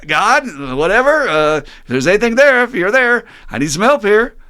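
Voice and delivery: high panicked yelling; desperate cry for help. Acted. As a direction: yell for help, terrified. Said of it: "he just happens to cry out".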